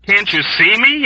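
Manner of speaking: high, loud, disbelieving cry; incredulous cry. A narrator's reading. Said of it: "Yossarian cried incredulously".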